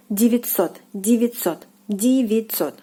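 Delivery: девятьсот said "dee-vee-TSOT", vowels as E and ть as ts. Shown as a demonstration. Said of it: "first two vowels are pronounced like E".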